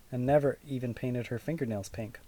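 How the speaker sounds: usual speaking voice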